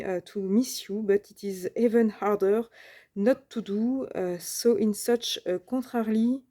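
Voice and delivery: slow, hesitant French-accented English reading; halting English in a heavy French accent; peculiar English accent. Real, not acted. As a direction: stumble through the English with a strong French accent. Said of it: "j'ai un accent anglais, euh, un peu spécial".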